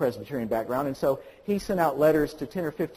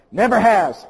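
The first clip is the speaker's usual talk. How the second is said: "never has" louder and higher; underlined words; 5 semitones higher, 8 dB louder than usual. Retold or imitated. Pastor's letter underlining, "never has" stressed hard.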